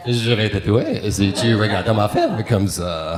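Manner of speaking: slow, low French lover voice; a romantic French murmur. Acted. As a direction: purr the threat like a French charmer. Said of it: "French people can say anything, and it sounds romantic".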